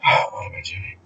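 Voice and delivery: unvoiced self-question; a muttered whisper to no one; whispered aside. Real, not acted. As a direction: whisper it to yourself, lost. Self-aside, losing the thread.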